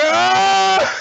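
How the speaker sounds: high, loud wailing shriek; cartoon howl of horror; child's mock scream. Child seeing the murder scene, mock scream.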